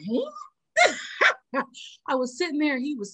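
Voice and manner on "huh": stunned huh. Retold or imitated. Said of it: "she was like".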